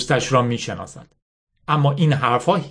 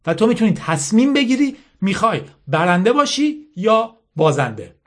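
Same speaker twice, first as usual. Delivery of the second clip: higher-pitched insistent lecture, lightly acted; bitter General Mala; 7 semitones higher than usual. Mala lecturing Yasmin on winners and losers, mildly bitter insistence. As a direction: drive the hard lesson home.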